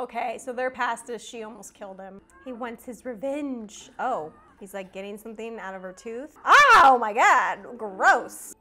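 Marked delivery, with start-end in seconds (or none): 6.5-8.5 loud, drawn-out disgusted exclamation; grossed out. Real, not acted. Viewer watching a tooth pulled out, loud drawn-out disgust. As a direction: stretch it out loudly, recoiling.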